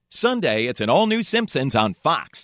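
bright network promo read; upbeat TV announcer plug; Fox promo voice. Shown as a demonstration. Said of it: "It would be".

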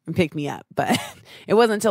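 ordinary talk

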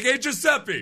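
brash New Jersey tourist voice; New Jersey accent. Pretend. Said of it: "use your New Jersey voice".

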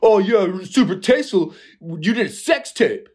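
high sneering sarcasm; mock a mean commenter; sarcastic troll. Imitating mean comments about Kim Kardashian, high sarcastic voice.

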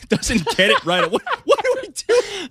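cracking up mid-read, pitch shooting up; real laughter. Real, not acted. Reader gives up on the article read aloud, helpless laughter. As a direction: lose the sentence to high laughter.

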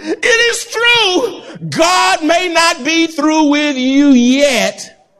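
emotional cry. Real, not acted. Preacher cries out emotionally.

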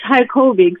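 Hikeobics said as hike-OH-bics; correct business-name pronunciation. Shown as a demonstration. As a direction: say Hikeobics carefully, like hike plus aerobics. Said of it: "how do you pronounce this business".

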